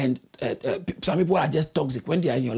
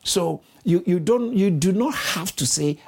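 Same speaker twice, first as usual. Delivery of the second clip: ordinary delivery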